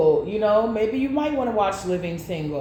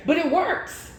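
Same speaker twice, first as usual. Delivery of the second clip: giggling through the words; laughing; 3 semitones higher than usual. Real, not acted. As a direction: let the laugh break through. Laughs at odd romance.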